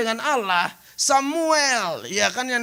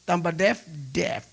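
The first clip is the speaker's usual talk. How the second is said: usual speaking voice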